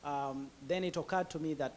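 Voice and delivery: ordinary talk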